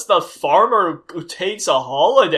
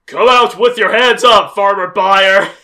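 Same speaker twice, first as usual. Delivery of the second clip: loud, higher bullhorn-style order; mock police shout; 4 semitones higher, 10 dB louder than usual. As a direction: bark it like cops outside the house. FBI calling out.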